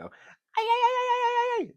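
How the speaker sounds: very high, loud trilled "ay ay ay"; hammed-up mariachi-style cry; mock Mexican yell. Mocking what the host wanted to do, high loud mariachi yell. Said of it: "put on a sombrero and go".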